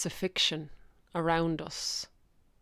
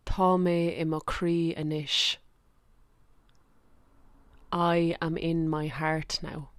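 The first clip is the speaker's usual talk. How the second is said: guided meditation. Acted. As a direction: guide listeners gently down into the heart. Leads a heart meditation.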